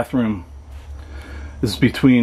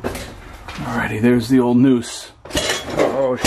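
ordinary delivery

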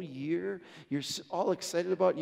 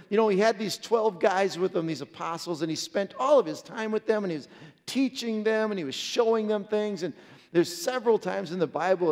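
a touch louder preacher's push; preaching, building again; 8 dB louder than usual. Acted. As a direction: lean into the story with renewed energy.

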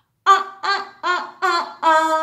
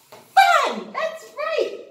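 excited praise; 13 semitones higher, 6 dB louder, much slower than usual. Acted. Praising child's answer.

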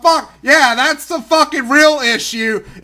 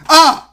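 short, loud, higher-pitched ugh; disgusted ugh; 5 semitones higher, 5 dB louder than usual. Real, not acted. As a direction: burst out with an exasperated grunt. Disgusted groan at the governor.

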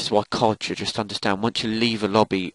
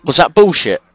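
loud, higher-pitched outraged challenge; angry protest; 5 semitones higher, 9 dB louder than usual. Real, not acted. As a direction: shout it in real indignation. Protesting a death in game.

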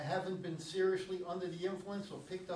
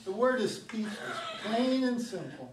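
laughing; 3 semitones higher, 5 dB louder than usual. Acted. Gina laughing over the kiss.

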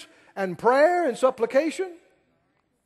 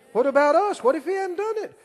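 higher and louder, urgent questions; rising challenge; 6 semitones higher, 4 dB louder, faster than usual. Acted. Preacher challenging the congregation, high and urgent. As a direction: press the congregation hard.

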